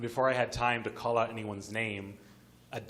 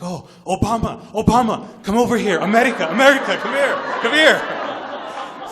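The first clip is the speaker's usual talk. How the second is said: very loud, high-pitched excited hollering; acted full-volume calling out; imitated wild yelling; 12 semitones higher, 16 dB louder than usual. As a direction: holler it like a frantic soldier waving someone over. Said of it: "yelling in Burmese".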